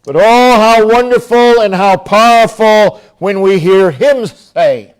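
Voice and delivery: normal talking voice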